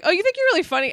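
high-pitched challenge, imitating the scammer; hammed-up taunting question; mock indignant retort.

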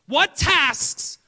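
loud, high preacher shout; preaching at full force. Acted.

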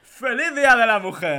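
laughing. Acted. Reading a cheesy greeting, laughing.

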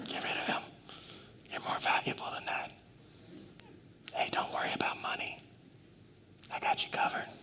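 hushed, intimate whisper of reassurance. Acted. Preacher voices God's encouragement to each listener, whispering. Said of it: "This is what God's whispering".

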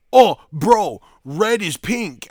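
high, loud mock voice of a stubborn friend; mocking Pino. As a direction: ham up the friend insisting, slow on red is pink. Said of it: "he's gonna say something like".

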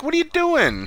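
high-pitched incredulous question; squeaky-high scandalized mimicry; mock alarm. Retold quote, alarmed at her drinking.

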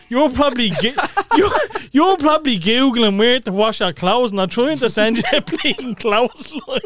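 loud, high, real laughter breaking into the words; laughing while talking. Real, not acted. Podcast host teasing a co-host, cracking up laughing. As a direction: crack up as you get the joke out.

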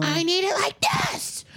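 put-on high, strained, drawn-out kid demand; child in meltdown. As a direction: imitate a child insisting mid-tantrum. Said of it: "then there's a giant meltdown".

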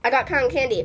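ordinary talk

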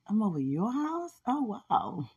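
a delighted gasp of disbelief; retold amazed surprise. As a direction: replay the shock of walking in. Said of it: "I went in the house and I was like".

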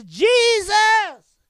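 high, loud call of the name. Retold or imitated. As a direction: call out the name to the blind man. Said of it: "He says".

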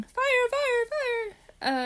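high-pitched pretend alarm cry; mock scream. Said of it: "She starts screaming".

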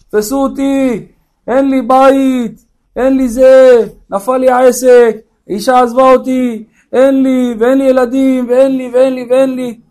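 high, sing-song mock lament; desperate rooftop jumper. Man on the roof listing his troubles to onlookers, high mock wailing. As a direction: drag out each complaint in hammed-up despair. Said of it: "איזה אחד עלה לגג".

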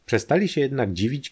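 plain reading voice

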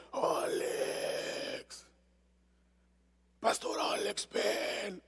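ghostly voiceless whisper calling a name; a faint, voiceless, otherworldly call; eerie demon whisper. Retold or imitated. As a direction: breathe it like a spirit luring you in. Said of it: "yo escuché una voz que decía".

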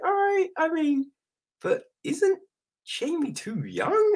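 squeaky-high mock hesitant dad; tentative, high-pitched caricature of a dad. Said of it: "And the dad's just a bit like".

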